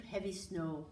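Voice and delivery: usual speaking voice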